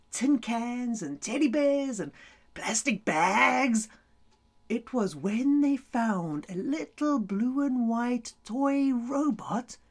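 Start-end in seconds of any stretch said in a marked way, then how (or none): none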